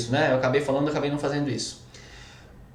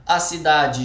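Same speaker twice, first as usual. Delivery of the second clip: ordinary talk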